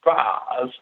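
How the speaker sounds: low, drawn-out mimicked accent on the surname; teasing accent imitation, pitched low; Deanna's accent. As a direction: imitate her accent with a long, broad vowel in Braz. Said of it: "her accent?".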